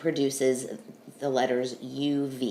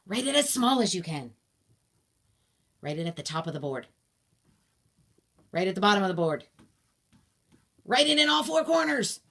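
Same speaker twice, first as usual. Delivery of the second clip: shouting directions. Real, not acted. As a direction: bark out the instructions. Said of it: "I'm gonna shout some directions at you".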